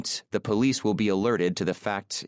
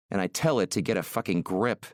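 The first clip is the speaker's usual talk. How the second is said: ordinary delivery, nothing marked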